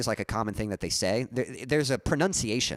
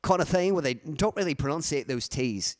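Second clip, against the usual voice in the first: English accent slipping toward Australian, higher; amateur casual English accent, pitched up; British accent, dropped T's; 5 semitones higher than usual. Shown as a demonstration. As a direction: keep up the T-dropping British accent while explaining. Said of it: "That's my best impression of it".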